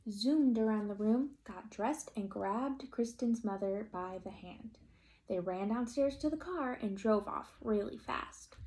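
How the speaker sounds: usual speaking voice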